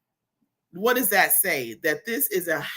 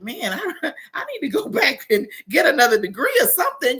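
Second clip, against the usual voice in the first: mock overwhelmed; 5 semitones higher, faster than usual. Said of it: "I'm like".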